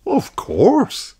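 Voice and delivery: high mother pig voice; Mummy Pig. Acted. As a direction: answer kindly as mummy pig.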